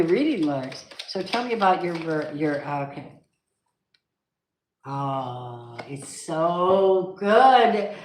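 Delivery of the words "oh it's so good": delighted. Real, not acted. Delighted at a good card.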